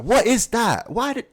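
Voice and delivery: high, loud disbelief; mock the haircut; high incredulous complaint. Real, not acted. Mocking haircut.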